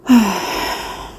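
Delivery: long, audible sighing exhale; deep breath out. Shown as a demonstration. Showing listeners a grounding breath, deep exhale. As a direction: let a deep breath fall out. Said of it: "mine was a deep breath".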